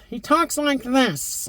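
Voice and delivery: high, slow, cackling cartoon villain; cartoon Skeletor voice. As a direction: play the screechy cartoon Skeletor, drawn out. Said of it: "in the cartoon, he talks like this".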